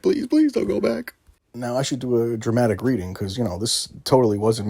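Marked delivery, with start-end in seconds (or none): 0.0-1.1 high, hammed-up begging squeak; mock pleading. Streamer mock-begging his screen recording not to return to the message, hammed-up high plea. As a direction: plead like a scared kid, far up in pitch.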